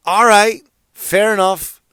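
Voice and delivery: high, loud show of giving in; loud, put-on "fine then" concession; acted grudging acceptance.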